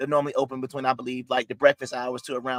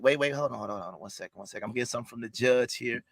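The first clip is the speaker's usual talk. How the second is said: hushed aside; 4 semitones lower, 6 dB quieter, faster than usual. Acted. Interrupts proceedings for judge.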